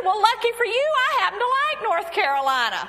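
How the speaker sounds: high, loud, hammed-up reply; eager agreement. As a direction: answer brightly, overplaying the keenness. Acts out her eager answer to him.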